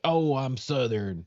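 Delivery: mock Southern. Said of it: "they can be like".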